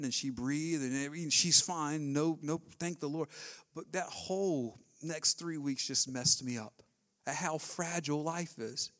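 ordinary talk

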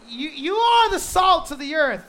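preacher proclaiming the verse loudly to the church; proclaimed quote. Acted. Quoting Jesus with emphasis.

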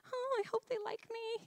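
high, softened anxious little plea; nervous. Retold or imitated. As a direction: fret hopefully in a high small voice. Retold nervous worry before meeting in-laws.

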